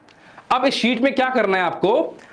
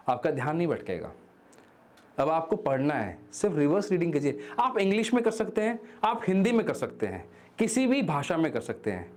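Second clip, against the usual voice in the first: hushed, soothing teacher voice, much softer; calm soft guidance; 9 dB quieter than usual. Acted. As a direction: speak gently as if calming the mind. Calm soft guided explanation. (शांत, धीमी आवाज़ में समझाना)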